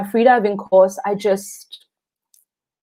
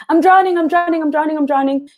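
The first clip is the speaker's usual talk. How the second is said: high, hammed-up drowning panic; flailing in the water, hammed-up panicked cry; mock panic; 6 semitones higher than usual. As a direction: play it as comic fake terror. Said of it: "and this is me".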